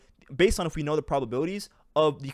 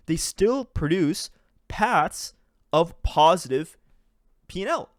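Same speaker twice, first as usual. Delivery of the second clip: emphatic; 4 semitones higher, 5 dB louder, much slower than usual. Real, not acted. Emphatic reveal of positive paths.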